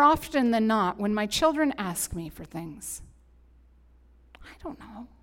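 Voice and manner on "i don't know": soft breathy whisper; whispered aside. Real, not acted. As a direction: drop to a whisper for a private aside.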